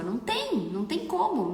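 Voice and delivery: normal talking voice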